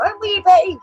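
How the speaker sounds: a hint of a high, cutesy Betty voice; lightly voiced mockery of Betty; mock wee Betty. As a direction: squeak it out like a put-on wee girl. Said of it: "playing the Scot card".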